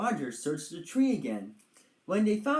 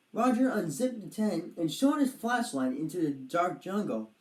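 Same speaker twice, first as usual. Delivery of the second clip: ordinary delivery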